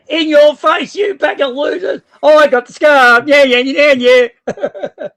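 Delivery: loud, slow, drawn-out gloat over the scarf; bad winner gloating. Host gloating at the chat over winning the scarf, loud and slow. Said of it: "'cause I'm a bad winner".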